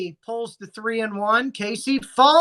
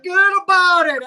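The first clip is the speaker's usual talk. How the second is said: put-on louder, faster New York accent; 7 semitones higher, 6 dB louder, faster than usual. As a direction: punch it out like a Brooklyn tough guy. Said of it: "now with her New York accent".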